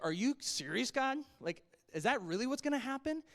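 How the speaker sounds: high, loud acted disbelief; rising, can't-believe-it questioning; incredulous. Old Abraham questioning God about having a child, incredulous. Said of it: "I can understand why he laughs".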